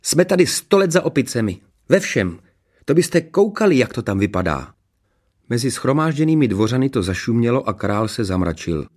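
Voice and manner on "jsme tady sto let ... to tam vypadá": high, excited chatter; enthusiastic princess. A narrator's reading. Princess telling courtiers they are outdated, enthusiastic. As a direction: rave on in a high voice. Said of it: "vykládala nadšeně princezna Ksenie".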